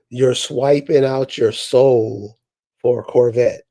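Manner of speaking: ordinary delivery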